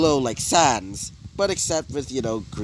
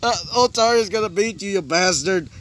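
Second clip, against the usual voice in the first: raised, high storyteller's yell; acted screaming; 9 semitones higher than usual. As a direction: shout defiance at your enemy, hammed-up. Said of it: "start screaming out".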